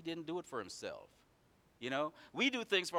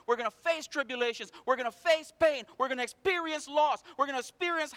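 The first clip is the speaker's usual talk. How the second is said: higher, louder, driving sermon cadence; preaching at full force; 12 semitones higher, 9 dB louder than usual. Acted. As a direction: hammer each line at the congregation.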